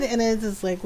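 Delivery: ordinary talk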